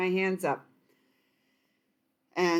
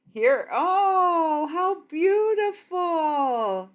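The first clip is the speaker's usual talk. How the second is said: much higher and louder, slow and awed; delighted gasp; 9 semitones higher, 6 dB louder, much slower than usual. Real, not acted. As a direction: let the card take your breath away. Reacting to a card reveal.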